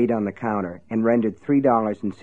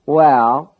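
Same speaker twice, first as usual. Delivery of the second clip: put-on drawling Down East Maine accent, louder; 3 semitones higher, 8 dB louder than usual. A narrator's reading. Sonny phones the sheriff, drawling Down East accent. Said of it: "in his drawling Down East accent".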